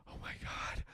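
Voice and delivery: terrified whisper of a mom; mother whispering in terror at a silhouette. Acted. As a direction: breathe it out in fear, unvoiced.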